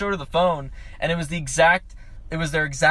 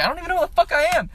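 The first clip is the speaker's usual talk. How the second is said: fast, high-pitched bewildered outburst; rapid retold exasperation, pitched up; flustered; 11 semitones higher, much faster than usual. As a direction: blurt it out, totally lost. Retells being lost and confused.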